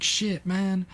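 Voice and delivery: high-pitched whiny imitation of crying; mock crying. Crying Peter watching Jackie Chan attack, mock distress. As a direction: play a distraught friend, voice pushed up high. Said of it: "He's fucking crying".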